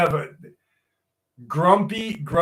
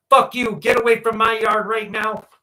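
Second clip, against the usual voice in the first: raised, higher-pitched mock yell; dad's angry yell; 6 semitones higher, 4 dB louder than usual. Dad to someone by his yard, angry retold yell.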